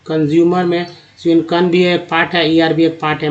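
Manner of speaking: normal talking voice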